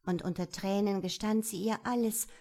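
plain reading voice

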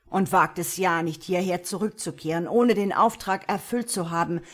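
loud, forceful, angry orders; enraged lord driving his servants out; roared threat. A narrator's reading. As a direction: bellow a warning as you dismiss them. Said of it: "brüllte er".

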